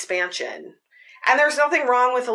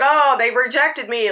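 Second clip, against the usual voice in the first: playful mock dismay; 5 semitones higher, 4 dB louder than usual. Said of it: "with a bunch of laughing faces".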